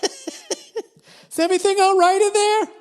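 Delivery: high, slow, worried outsider; high, slow voice of a worried roommate; concerned voice outside. Retold or imitated. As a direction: call in through the bathroom door. Someone checking from outside shower.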